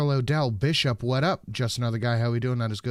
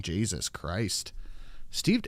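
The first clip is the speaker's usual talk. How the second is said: usual speaking voice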